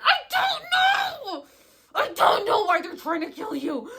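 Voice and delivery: high, loud shouting through tears; shouting at the ground while sobbing; anguished shout. Retold or imitated. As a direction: yell it out to the earth in anguish. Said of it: "punched the ground".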